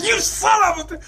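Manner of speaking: very high, strangled mock fury; mock outrage. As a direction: sputter it out, hammed-up. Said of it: "The guy's like".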